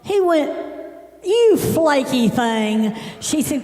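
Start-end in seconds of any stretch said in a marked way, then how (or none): 1.2-3.0 very slow, drawn-out mock insult; hammed-up slow scolding; indignant insult. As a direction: imitate a scornful dad, stretching every word. Father's retold insult to woman.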